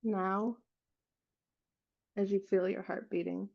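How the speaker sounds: unhurried meditation guide, long silences between phrases; guided meditation. Real, not acted. Leading listeners to relax and feel their hearts, guided meditation voice. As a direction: guide them gently, leaving space after each phrase.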